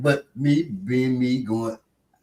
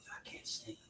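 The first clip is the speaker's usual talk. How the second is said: barely audible whisper, no voice; hushed, breath-only reply; imitated whisper; 29 dB quieter than usual. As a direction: whisper it to get round a stutter. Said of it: "started him just talking with whispers".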